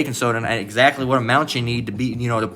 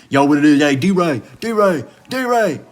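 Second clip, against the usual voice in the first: high, hollered street slang; caricatured street greeting, pitched way up; mocking street impression; 7 semitones higher than usual. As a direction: call out to your boys, mockingly. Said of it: "No, you see".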